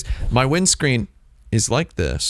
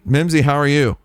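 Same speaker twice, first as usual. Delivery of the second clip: normal talking voice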